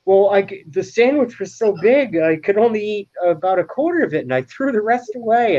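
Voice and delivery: high, breezy careless coworker; high offhand sitcom voice; coworker quoted. Retold or imitated. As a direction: toss it off, pitched high. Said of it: "the guy goes".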